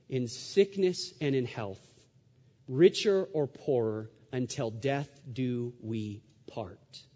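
low, slow recited wedding vow; solemn vow. Retold or imitated. Husband recalling the covenant he made with his wife, solemnly. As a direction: let each phrase land, softening to the end.

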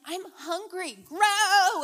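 urging the seeds to grow, mock-desperate; impatient plea. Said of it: "And I'm like".

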